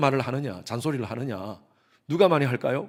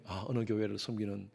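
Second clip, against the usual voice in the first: softer, modest self-introduction; humble parents' reply; 7 semitones lower, 11 dB quieter than usual. Retold or imitated. Parents humbly telling the pastor their church titles. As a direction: answer the pastor politely and softly. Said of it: "그렇게 말씀하시는 거예요".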